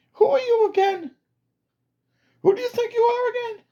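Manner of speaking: high, sneering disbelief; mocking soldier. Soldier mocking the self-declared commander, high sneering voice. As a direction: scoff at the commander's claim to rank.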